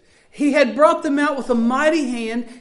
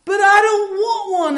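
put-on higher child's whine; crying child; 7 semitones higher, 4 dB louder than usual. Preacher imitating his child begging, high whine. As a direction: whine it like a tearful kid. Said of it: "And they would cry".

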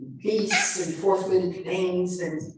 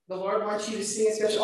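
ordinary talk

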